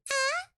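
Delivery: high squeaky little yawn; small creature yawn sound; tiny yawn. Pretend. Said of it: "It's going".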